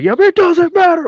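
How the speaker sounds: high, loud mocking sergeant; imitated sergeant sneering at the girl; mock sergeant yell. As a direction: toss it off like a mean drill sergeant. Sergeant dismissing the crying girl.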